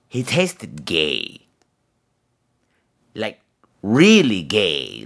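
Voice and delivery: low and very slow, relishing; slow savoring verdict. Acted. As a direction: drawl the punchline slowly.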